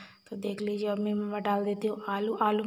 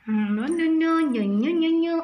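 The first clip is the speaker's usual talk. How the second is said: put-on baby talk; 7 semitones higher, 4 dB louder, slower than usual. Teasing baby.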